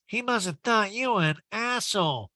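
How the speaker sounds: high-pitched mocking impression; Erica. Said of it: "And Erica goes".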